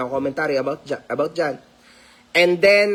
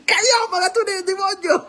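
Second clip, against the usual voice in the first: dramatic cry. Acted. Dramatic replay of his catchphrase.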